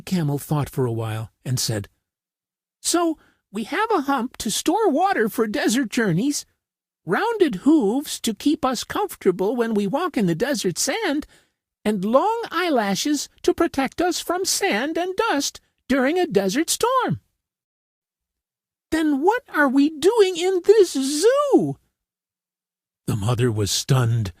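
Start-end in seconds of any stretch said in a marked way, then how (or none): 2.8-17.2 put-on high, childlike camel reasoning aloud; a young camel working it out; baby camel voice. A narrator's reading. Baby camel reasoning to his mother, high and childlike. 18.9-21.8 high, slow innocent question; a child asking the obvious, slowly; puzzled baby camel. A narrator's reading. Baby camel asking his mother, high and slow.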